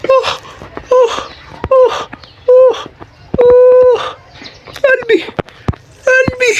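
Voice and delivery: high-pitched frantic wailing; high panicked cries. Real, not acted. Gamer just escaped the monster, panicked high cries. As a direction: cry out, heart racing. Heart pounding after fight.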